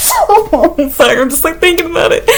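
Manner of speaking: giggling high through the words; laughing. Real, not acted. Laughing at herself while remembering the bug. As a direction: laugh it out, a little helpless.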